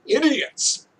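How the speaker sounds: high, sneering "Idiots"; scornful. Acted. Reading a visual novel, the heroine mocks the investors scornfully. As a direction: spit the word with contempt.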